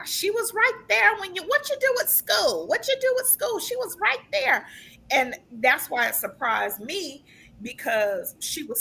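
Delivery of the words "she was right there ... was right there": much higher, imitating an elderly lady; high, quavering grandma impression; high old lady voice. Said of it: "She said".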